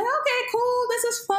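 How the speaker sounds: very high-pitched, playful delight; mock giddy. Retelling her first high to co-host, mock giddy. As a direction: act out giddy fun in a squeaky-high voice. Re-enacting herself enjoying the high.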